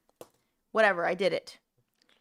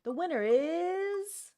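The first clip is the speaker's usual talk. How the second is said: game show announcer; 9 semitones higher, 3 dB quieter, much slower than usual. Pretend. Announcing wheel result.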